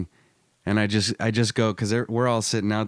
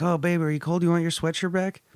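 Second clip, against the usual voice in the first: higher, sweet mock girlfriend; raised voice imitating the girlfriend; girlfriend's voice; 7 semitones higher than usual. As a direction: put on her sugary concern. Girlfriend voiced in retold story.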